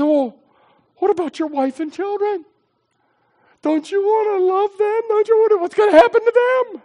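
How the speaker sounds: high-pitched mock worrier; fretting objectors. Voices worried people objecting.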